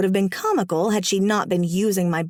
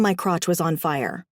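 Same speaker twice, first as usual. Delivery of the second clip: ordinary delivery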